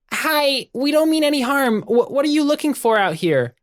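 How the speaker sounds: very high, small-creature voice; friendly Webby. Acted. Webby assuring a stranger they mean no harm, high friendly voice. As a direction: pipe up sky-high to reassure a stranger.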